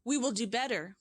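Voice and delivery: ordinary delivery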